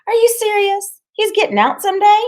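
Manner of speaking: very high, loud, astonished questions; incredulous, pitch shooting right up; shrill disbelief. Acted. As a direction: squeal it as if stunned by the news. Loretta astonished at the news.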